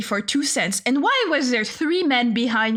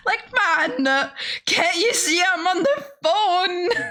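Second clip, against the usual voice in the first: high, drawn-out pleading; comically aggrieved, higher voice; exasperated whine; 6 semitones higher, slower than usual. Retold or imitated. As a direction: whine it out, stretching the last word. Whining at man who bothers her.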